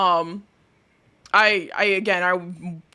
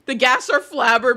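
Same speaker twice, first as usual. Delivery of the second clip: ordinary delivery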